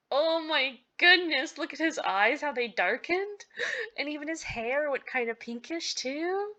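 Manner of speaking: high-pitched, marvelling gush; amazed gushing. Real, not acted. Player marvelling at his darkened eyes, high amazed gush.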